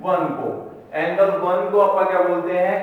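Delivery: usual speaking voice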